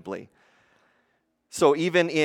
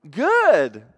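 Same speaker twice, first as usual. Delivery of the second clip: high, loud, drawn-out "good"; insistent stock answer; 5 semitones higher, 7 dB louder than usual. Acted. Person asked how they are really doing, overbright insistence. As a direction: overdo it to deflect the question.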